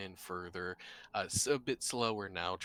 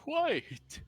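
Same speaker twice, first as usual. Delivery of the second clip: sudden exclamation; 4 dB louder than usual. Acted. Sudden interruption.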